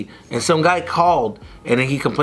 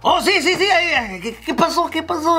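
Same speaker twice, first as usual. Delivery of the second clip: high excited voice of the restaurant guy, Spanish; 13 semitones higher than usual. Retold or imitated. Cook greeting the stepson in Spanish, high excited mock voice. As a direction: play the surprised cook speaking Spanish, up high.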